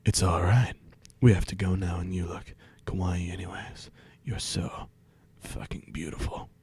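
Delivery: low breathy seductive whisper; sexy voice. Acted. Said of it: "sorry, in a sexy voice".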